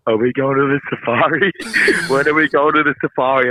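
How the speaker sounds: usual speaking voice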